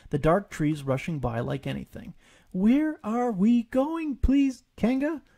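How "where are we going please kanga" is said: high, slow timid little voice; small Piglet. A narrator's reading. Piglet asks Kanga from her pocket, timid and high. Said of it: "Piglet cried".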